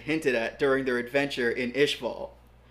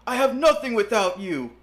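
protesting; 7 semitones higher, 5 dB louder than usual. Acted. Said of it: "Alexander protested".

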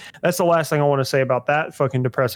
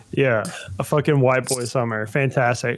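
ordinary delivery

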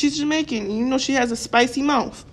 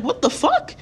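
incredulous, higher, with a laugh; shocked what the fuck; 7 semitones higher than usual. Retold or imitated. Telling young men who curse their fathers, incredulous laughing disbelief. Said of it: "I'm like".

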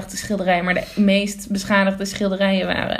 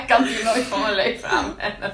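laughing. Real, not acted. Still laughing.

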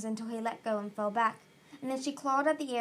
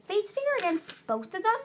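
high, loud, incredulous question; protesting cry; 10 semitones higher, 5 dB louder than usual. A narrator's reading. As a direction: cry out in disbelief. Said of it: "Starflight cried".

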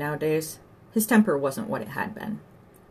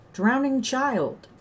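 high, long-drawn call; slow, stretched cry for help; shouting alarm; 8 semitones higher, 3 dB louder, much slower than usual. A narrator's reading. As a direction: call it out across the pond.

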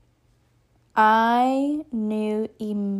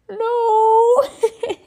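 high, loud mock-crying wail; pained wail; 11 semitones higher, 7 dB louder than usual. Injured skier answering her friend, acted tearful wail. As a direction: wail it long and high, acting hurt.

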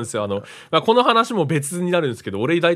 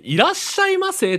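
high, sing-song clerk welcome. Retold or imitated. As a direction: chirp it like a store clerk. (高く明るい店員の「いらっしゃいませ」)

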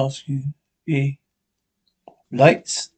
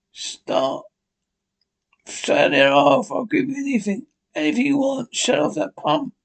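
breathless, panicked begging, voice straining; gasping for air; 7 semitones higher, 4 dB quieter than usual. Acted. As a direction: plead while struggling for breath. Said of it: "Begins to breathe heavily".

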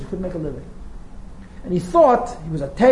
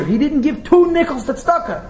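scornful emphasis; 8 semitones higher, 4 dB louder than usual. Retold or imitated. Scornful aside about the miser.

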